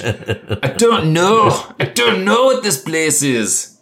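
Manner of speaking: an accent attempt that keeps sliding into Scottish, then cracks up; slipping Scottish accent. GM voicing a prisoner, accidentally Scottish accent. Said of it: "and I just keep going Scottish".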